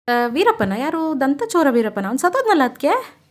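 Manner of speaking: rising high, loud mock confusion; baffled surprise. Brothers-in-law puzzled at the name Veerappan, in loud mock surprise. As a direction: play the clueless one, hammed-up.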